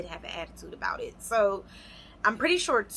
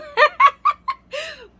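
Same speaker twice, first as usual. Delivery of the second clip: big high burst of laughter, louder; 12 dB louder than usual. Real, not acted. Vlogger cracking up at her own story, loud laugh.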